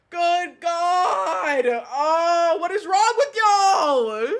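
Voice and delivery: high, loud, drawn-out wail; appalled wail. Real, not acted. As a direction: wail at the chat in despair. Appalled at what they just read.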